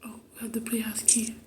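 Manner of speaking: quick unvoiced whisper. Real, not acted. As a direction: whisper it fast and excited. Sneaking in a horror game.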